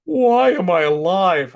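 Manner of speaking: loud, drawn-out mock wail; hammed-up existential despair, pitched high; despairing cat. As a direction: wail it as a melodramatic pet. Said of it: "And he's like".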